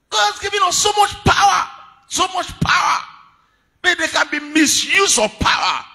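high, loud, shouted sermon; full-force preaching. Acted. Preacher charging the church for the new year, full-force shouted preaching. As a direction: cry it out to the whole congregation.

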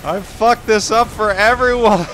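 high, loud cry of failure; hysterical wail. Real, not acted. Player who ruined it for everyone, hysterical wail. Wailing at own mistake in game.

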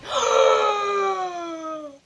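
loud high crying shout; anguished cry. Real, not acted. Reacting to the death scene, a crying scream. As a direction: let out a wailing cry.

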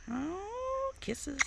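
high, soft coo; pet cooing. Real, not acted. Owner responding to the bird's kisses, high cooing pet talk.

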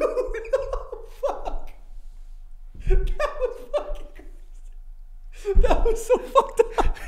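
wheezing high laughter through the words; laughing too hard to speak; helpless laughter. Real, not acted. Cracking up at his friend's tower joke, breathless laughing.

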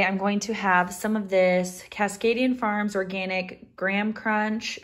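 usual speaking voice

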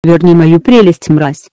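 loud furious yell; angry shout. Acted. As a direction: bellow it in a rage. Furious demand for stolen treasure.

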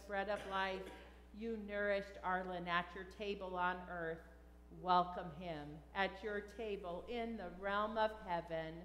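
ordinary talk

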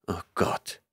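soft breathy groan, barely voiced. A narrator's reading. Dixon groans over the hopeless mess, soft and voiceless. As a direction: let it out as a quiet defeated moan. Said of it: "stöhnte ich auf".

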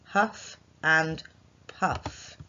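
"to huff and puff" very slow, word by word; slow dictation. Shown as a demonstration. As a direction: dictate each word slowly. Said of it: "Remember that sentence".

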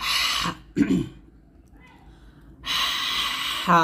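pressed, breathy throat h in হা; চিকন হা. Shown as a demonstration. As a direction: squeeze হা from mid-throat, air first. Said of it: "চাপ দিয়ে এবং চিকন আওয়াজে". (হা চাপ দিয়ে চিকন আওয়াজে)

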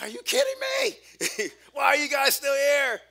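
very high, slow, shouted disbelief with a laugh. Retold or imitated. Scared kid seeing the whole school waiting, shocked high shout. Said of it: "I'm like".

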